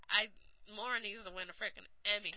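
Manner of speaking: usual speaking voice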